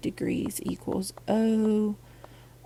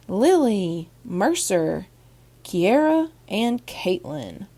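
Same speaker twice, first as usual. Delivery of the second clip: loud proud roll call of names; excited announcement; 2 semitones higher, 6 dB louder, slower than usual. Real, not acted. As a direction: announce each winner with a flourish. Announcing winners after drum roll.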